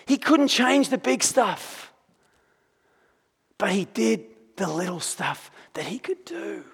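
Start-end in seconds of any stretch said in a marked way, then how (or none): 3.6-6.6 hushed, breathy whisper of the point. Real, not acted. Preacher whispers the lesson about little things to church. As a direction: lean in and whisper it to the congregation.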